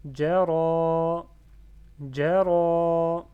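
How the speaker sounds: JARA with a long held final 'a'; loud recitation, stretch the end of JARA; prolonged fatha. Shown as a demonstration. Teacher demonstrating the lengthened vowel, JARA with its final 'a' held. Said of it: "il va prolonger le fatha".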